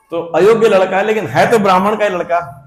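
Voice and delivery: ordinary talk, nothing marked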